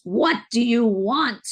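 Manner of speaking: put-on a little girl's demand, staring into her eyes, loud and slow; little girl demanding what she wants, slow and loud; plain-accented child's demand. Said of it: "No accent or anything, just".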